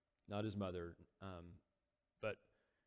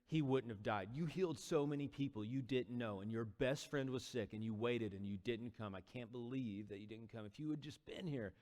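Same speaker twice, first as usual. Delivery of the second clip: angry, grieving reproach; 3 semitones higher than usual. Acted. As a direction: pour out grief as accusation. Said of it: "she's kinda angry".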